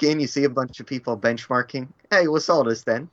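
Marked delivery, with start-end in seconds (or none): 2.1-3.1 put-on Cockney accent. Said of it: "proper Cockney accent".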